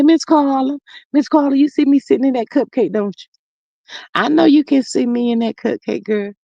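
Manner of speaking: usual speaking voice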